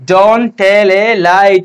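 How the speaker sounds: very slow, drawn out; slow dictation. Shown as a demonstration. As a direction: repeat it slowly for the class. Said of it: "কি বলবো আমরা".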